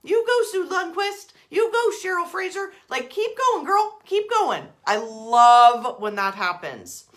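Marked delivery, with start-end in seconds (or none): 0.0-4.6 high, loud hype for each name; cheering universe. Pretend. Universe cheering on the viewers, high and loud. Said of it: "comes in and goes".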